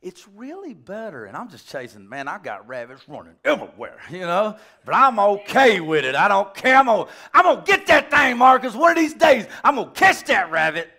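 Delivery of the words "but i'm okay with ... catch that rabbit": loud, high excited rant; mock rant. Preacher jokes about chasing his stray rabbits, loud mock rant. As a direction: playfully yell about hunting it down.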